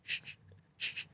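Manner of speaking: hushed, very soft reassurance with shushes; mock soothing. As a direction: shush them like a fussing baby. Mock-soothing an angry driver.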